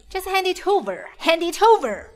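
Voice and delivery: furious demand. Acted. Attacker demands the company from captive, high and escalating. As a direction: demand it fiercely, escalating on the repeat.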